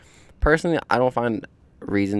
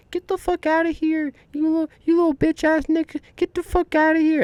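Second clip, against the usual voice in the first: squeaky, much higher mock voice of a guard chasing skaters off; mocking security guard. Skater mimics a security guard kicking him out, squeaky mock voice. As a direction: lampoon the guard in a shrill cartoon falsetto. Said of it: "they'll be like".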